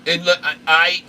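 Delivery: ordinary talk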